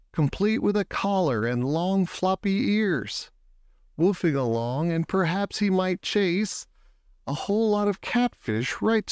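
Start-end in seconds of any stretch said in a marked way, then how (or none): none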